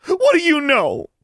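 very high, loud desperate shout. Acted. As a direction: scream it at her. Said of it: "Mary shouted".

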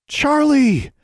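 high, loud joyful call; gleeful cry. A narrator's reading. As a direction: cry out the name in delight. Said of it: "cried out with glee".

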